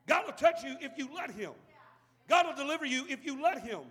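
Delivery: loud fervent preaching; preaching at full force. Acted. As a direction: hammer it home to the congregation.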